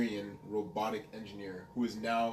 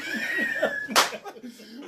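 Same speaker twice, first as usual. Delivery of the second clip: high laugh; 11 semitones higher than usual. Real, not acted. Laughing at his own impression.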